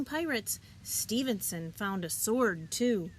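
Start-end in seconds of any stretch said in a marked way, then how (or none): none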